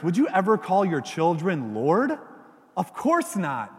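normal talking voice

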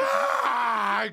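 mock scream. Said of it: "she goes".

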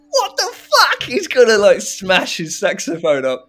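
high, loud startled outburst; a thought blurted in shock; shocked disbelief. Retold or imitated. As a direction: gasp it out in amazed alarm. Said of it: "And I thought".

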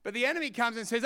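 normal talking voice

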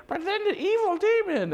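high-pitched, theatrical British narrator; mock British narration. Mocking the Bible audio's voice actors, hammed-up British accent. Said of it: "it's like in a British accent".

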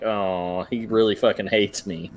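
low, softly deflated mock mom; imitated crestfallen mumbling; disappointed. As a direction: let it sag, low and dejected. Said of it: "looked down, disappointed, like".

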